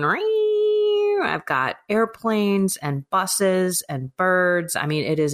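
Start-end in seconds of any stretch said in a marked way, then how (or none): 0.0-1.2 high, long steady whine; sustained eee of a whining machine; whiny laptop noise. Retold or imitated. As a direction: imitate a laptop's high-pitched whirr. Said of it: "whiny laptop behind me going".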